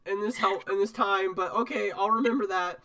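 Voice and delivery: put-on high, stammering boy voice; Morty. Small knight politely fending off a boast, high Morty-like stammer. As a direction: keep it high, restarting awkwardly mid-phrase. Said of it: "why do you sound like Morty".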